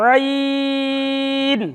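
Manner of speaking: আইন with the আ stretched very long, four to six counts; long drawn vowel. Shown as a demonstration. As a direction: hold the আ of আইন long from mid-throat. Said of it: "আটা যেন লম্বা না হয়". (আইন এর আ টেনে লম্বা করে বলা)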